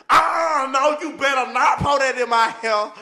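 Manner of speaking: high loud shout, slow; hammed-up outraged yell; mock shrieking woman. Imitating a woman shouting not to pour water on her hair.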